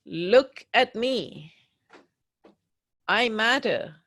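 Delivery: loud, slow, insistent demand to be heard; pleading yell. Pretend. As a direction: shout it slowly like you're on their chest. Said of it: "jump up and down on somebody's chest and say".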